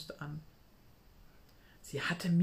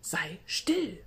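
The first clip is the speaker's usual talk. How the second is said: urgent whisper in a different voice; whispered hush. A narrator's reading. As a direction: hush her friend urgently. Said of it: "zum Schweigen".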